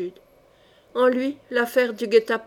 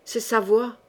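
usual speaking voice

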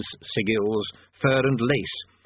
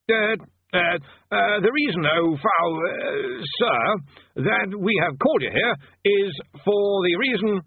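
high, nervous stammering wizard; flustered bursar; 9 semitones higher, slower than usual. A narrator's reading.

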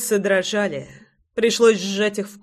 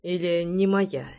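ordinary delivery, nothing marked

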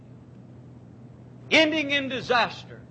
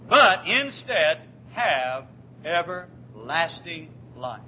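slow, solemn reading of the verse; scripture reading; 3 semitones lower, slower than usual. Acted. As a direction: draw the verse out like holy writ. Preacher reads scripture slowly.

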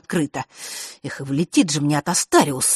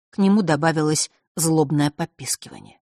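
ordinary delivery, nothing marked

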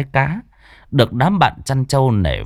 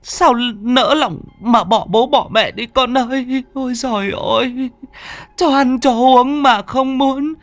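wailing lament; 13 semitones higher than usual. A narrator's reading. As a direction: wail over the dead child like a mother. Grandmother wailing over dead son. (mẹ khóc than thảm thiết, giọng cao, kéo dài)

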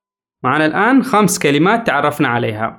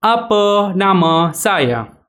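Apa nama Anda said very slowly, word by word; slow teacher demo; slow model phrase; much slower than usual. Shown as a demonstration. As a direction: spell it out slowly for learners to repeat. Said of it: "مرة أخرى".

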